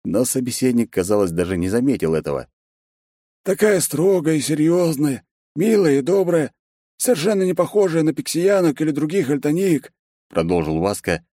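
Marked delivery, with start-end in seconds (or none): 3.4-9.9 high voice with stretched vowels; dreamy. A narrator's reading. Secretary gushing about the boss's wife, high and dreamy. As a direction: draw out the vowels, lost in admiration. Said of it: "мечтательно растягивая гласные".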